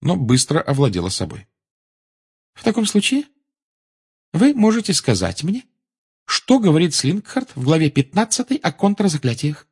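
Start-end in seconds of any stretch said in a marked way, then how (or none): 2.5-9.7 high-pitched teacher's voice, questioning; prim teacher Umbridge. A narrator's reading. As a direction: quiz the pupil in a high, controlled voice. Narrator plays the teacher Umbridge. (высокий голос строгой преподавательницы)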